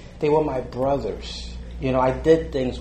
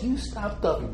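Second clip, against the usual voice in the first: mock teammate jab; 10 semitones higher than usual. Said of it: "And he goes".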